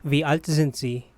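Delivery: ordinary delivery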